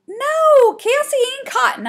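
high, loud exasperated snap; snapped. A narrator's reading. Girl correcting her brother about the culprit, snapping. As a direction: snap it back high and hard. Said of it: "I snapped".

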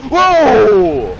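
loud, high drawn-out whoa; startled shout. Real, not acted. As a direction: yell out in shock. Startled by a towering enemy.

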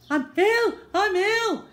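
high, loud strained mock whisper; hoarse stage whisper. Boy feigning illness to the nurse, loud strained whisper. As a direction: strain it out as a sick child. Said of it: "whispered Henry".

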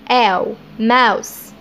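آو drawn out slowly as a round ow before mouse; the ow sound. Shown as a demonstration. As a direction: stretch the ow sound so they hear it. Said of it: "إن ال أو يو اتنطقت آو". (آو ممدودة ببطء)

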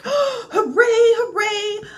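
high, loud delighted whoop after a gasp; gasping cheer. A narrator's reading. Dog spotting an indoor dog park, cheering. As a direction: burst out cheering with joy.

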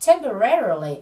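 "Temporarily" peaking on RA, then falling; stress on third syllable. Shown as a demonstration. Teacher modelling the word for students to repeat, "Temporarily" stressed on RA. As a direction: punch the RA in "Temporarily". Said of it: "nhấn vào âm re ở âm tiết thứ ba".

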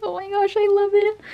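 high-pitched gushing delight; delighted squeal. Real, not acted. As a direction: gush over it in a high voice. Delighted by new skirt.

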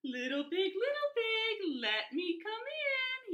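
lightly voiced frail old beggar, high and slow; a hint of a feeble old coyote; old weak coyote. A narrator's reading. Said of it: "pretended to be very old and weak".

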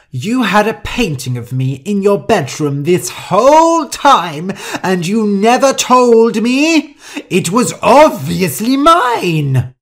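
loud, high shriek of indignation; outraged. Acted. Rosa learning Shu had her painting, outraged shouting. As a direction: draw out the key words in shrieking outrage.

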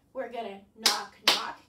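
loud punched knock, knock; demonstrated knocks. Shown as a demonstration. Teacher shows the children the knocking motion, loud. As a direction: knock out each word loudly. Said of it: "Can you do this?".

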